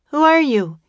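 high, loud call across the yard; boy calling to newcomers. A narrator's reading. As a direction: call it out to strangers. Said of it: "he called".

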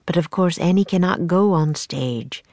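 plain reading voice

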